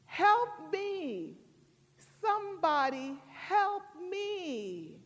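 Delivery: high, drawn-out mock cry for help; man calling for help. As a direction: call up to the sky, stretching the plea. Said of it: "looked up to the sky and he says".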